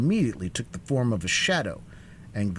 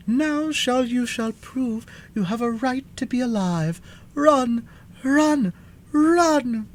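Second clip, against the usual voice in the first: high, commanding cry building louder; urgent cry; 4 dB louder, slower than usual. A narrator's reading. Sorceress urging the sawhorse to chase, crying out. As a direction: cry out high, hammering each 'run'. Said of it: "sprang upon the back of the sawhorse and cried".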